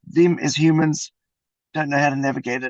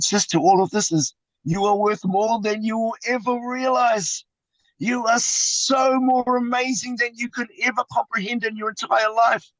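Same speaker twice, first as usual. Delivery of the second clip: uplifting; 5 semitones higher than usual. Real, not acted. Uplifting message to listener.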